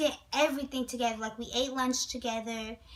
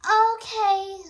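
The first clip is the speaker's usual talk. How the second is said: stunned okay; 7 semitones higher, 8 dB louder than usual. Retold or imitated. Stunned reply to confession.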